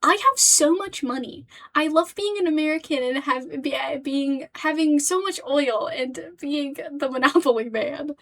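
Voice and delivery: hammed-up bragging American; mocking boastful rich man. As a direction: crow it like a gloating tycoon. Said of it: "every time he talks, he's like".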